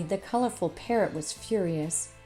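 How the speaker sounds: ordinary delivery, nothing marked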